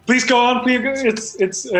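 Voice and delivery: usual speaking voice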